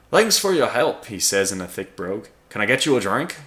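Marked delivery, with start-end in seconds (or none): 0.1-1.1 loud, high stab at an Irish brogue; a shaky Irish brogue, slipping between accents; attempted Irish brogue. Irish brogue for the red-bearded giant in the bar. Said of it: "this accent is not going to be good". 2.5-3.4 loud Irish brogue offer; attempted Irish brogue. Giant offers a drink in a wobbly Irish brogue. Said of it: "this accent is not going to be good".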